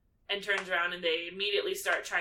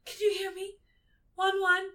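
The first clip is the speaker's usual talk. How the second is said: much higher, cooing mock-sweet voice; hammed-up lovesick cooing; doting Lavender; 13 semitones higher, 4 dB louder than usual. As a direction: coo over the sleeping boyfriend. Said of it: "she's like".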